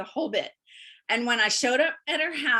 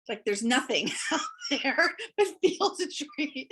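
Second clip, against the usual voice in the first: laughing; 3 semitones higher than usual. Real, not acted.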